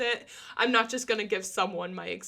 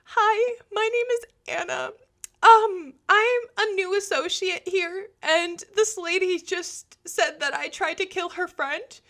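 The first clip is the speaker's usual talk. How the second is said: high, fast, near-crying rush of words; on the verge of tears; 7 semitones higher, 5 dB louder than usual. Retold or imitated. New associate telling Jamie about the accusation, near tears. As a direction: blurt it out close to tears. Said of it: "I was at the verge of tears".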